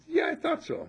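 high, laughing imitation of the friend; laughing quote. Friend teasing about his age, high laughing quote. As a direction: laugh through the friend's reply. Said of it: "He says".

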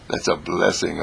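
ordinary delivery

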